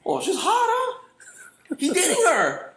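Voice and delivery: high question-like pidgin; everything sounds like a question; high up talk. Shown as a demonstration. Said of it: "you have to talk high".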